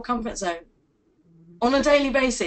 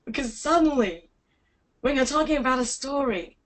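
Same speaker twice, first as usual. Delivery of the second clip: ordinary talk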